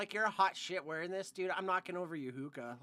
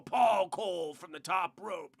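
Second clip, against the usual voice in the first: slow, louder ring announcer call; wrestling announcer; 5 dB louder, slower than usual. Pretend. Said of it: "such a badass wrestling name".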